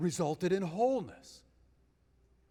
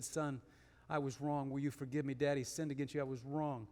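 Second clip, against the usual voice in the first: soft, lower, humble confession; quietly voicing a father asking his son's forgiveness; tender apology; 5 semitones lower, 7 dB quieter than usual. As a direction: kneel and plead gently. Father kneels, asks son's forgiveness.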